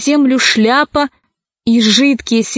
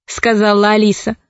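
usual speaking voice

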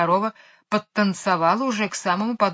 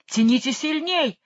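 загремел; 7 semitones higher than usual. A narrator's reading. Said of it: "загремел король".

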